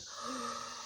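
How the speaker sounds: soft mock gasp of alarm; frightened gasp. Imitating her reaction to the salt, alarmed gasp. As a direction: imitate a wary sharp intake of breath. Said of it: "She was like".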